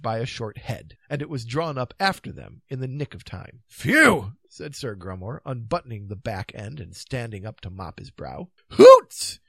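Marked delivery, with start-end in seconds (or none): 3.7-4.3 loud puff of relief; relieved phew. Acted. Knight safe over the drawbridge, relieved. Grummore relieved after escape. 8.7-9.4 very high, loud whoop; shrieking old-wife exclamation; cried out. A narrator's reading. Old wives greeting the knights, crying out. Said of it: "cried various old wives".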